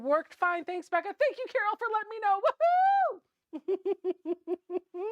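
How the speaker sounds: very high, squealing excitement; high-pitched giddy cheer. Real, not acted. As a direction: let the thanks climb into a giddy squeal. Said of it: "Why am I so giddy tonight?".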